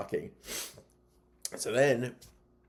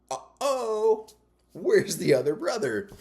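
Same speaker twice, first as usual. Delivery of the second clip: mock alarm; 12 semitones higher, 5 dB louder than usual. Kid finding his ally missing from the roof, mock alarm. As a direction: play up the sudden worry, pitched high. Retold alarm as plan fails.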